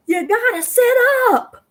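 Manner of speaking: high, loud urging; urging call. Retold or imitated. Wife urges her slumped husband to paddle, high loud call. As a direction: tell him firmly to sit up.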